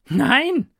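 high, loud defiant no; determined refusal. Acted. Taster refuses the jester, high and defiant. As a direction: refuse firmly. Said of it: "sagte Vorkoster Edward entschlossen".

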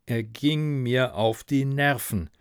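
ordinary delivery, nothing marked

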